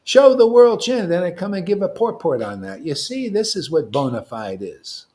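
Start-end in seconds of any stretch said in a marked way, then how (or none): 0.0-1.1 louder, slow urging command; rally the crowd to chant; rallying call. Retold or imitated. Guru urging devotees to chant, loud. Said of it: "That's why I said".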